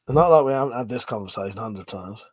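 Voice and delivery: usual speaking voice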